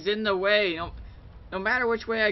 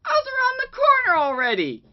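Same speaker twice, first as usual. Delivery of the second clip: high, fast, frantic ranting; panicking gamer; 3 dB louder, faster than usual. Real, not acted. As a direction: rant at the screen, still heated. Ranting after the unfair death.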